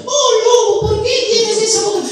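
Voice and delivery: put-on very high sing-song little-girl voice; Caperucita. Asking the wolf about big ears, sing-song falsetto.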